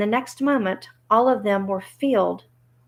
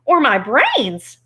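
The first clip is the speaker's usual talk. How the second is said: slow, higher-pitched mild wail; 5 semitones higher, 5 dB louder, slower than usual. Acted. Scarecrow despairs of his brains, mildly wailing. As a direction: draw it out in a light wail. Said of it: "wailed the Scarecrow".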